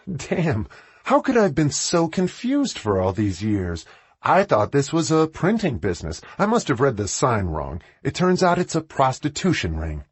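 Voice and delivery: high, mock-bewildered sarcasm; biting sarcasm. A narrator's reading. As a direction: feign confusion to mock him. Winnie mocks the boss.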